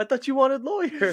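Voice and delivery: high, loud comic protest; mock dismayed mom. Mom whose kid dropped the lawyer plan, mock dismay. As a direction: protest shrieking, as a hammed-up mother. Acting out mom's dismayed reply.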